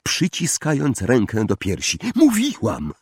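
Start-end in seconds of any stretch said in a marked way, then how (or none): 2.1-2.9 high, breathless, triumphant; breathless told-you-so; panting insistence. A narrator's reading. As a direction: gasp it out, still winded. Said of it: "wydyszała Hermiona".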